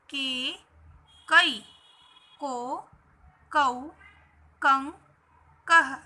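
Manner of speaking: "के, कई, को, कौ, कं, कह" one by one with clear pauses; syllable recitation. Shown as a demonstration. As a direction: drill each from "के" to "कह" as a separate held syllable. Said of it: "इनको हम इस तरीके से पढ़ेंगे". ("के" से "कह" तक अलग-अलग खींचकर उच्चारण)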